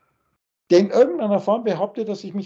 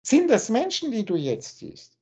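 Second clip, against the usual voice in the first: usual speaking voice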